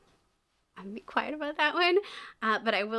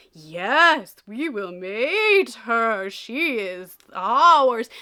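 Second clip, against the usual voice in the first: mock dragon, dragging out every syllable, loud and swooping; sing-song dragon voice; 9 dB louder, much slower than usual. Reviewer mimicking the audiobook narrator's dragon, loud drawn-out sing-song. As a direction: imitate a narrator's very slow, sing-songy dragon. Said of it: "make his voice slightly deeper and then drag out every syllable".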